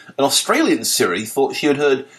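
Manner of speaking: normal talking voice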